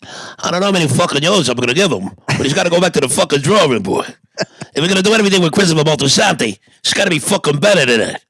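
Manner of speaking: fast, put-on tough-guy rant; ranting mobster. Host judging a listener's Sopranos script, fast mobster rant. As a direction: rattle off a mock-exasperated mob verdict.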